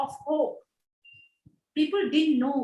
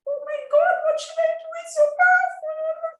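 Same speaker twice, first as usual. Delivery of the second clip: much higher, breathless astonishment; overwhelmed gasp. Retold or imitated. Telling the audience how the lady's tears overwhelmed her, high and stunned. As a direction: cry it out high, overcome.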